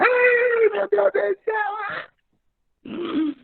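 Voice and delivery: very high wailed cry, drawn out slowly; mock wailing. Crying out to God in the prayer circle, mock wailing. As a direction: imitate someone bawling to God. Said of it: "Comenzó a llorar".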